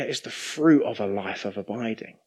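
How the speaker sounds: ordinary talk, nothing marked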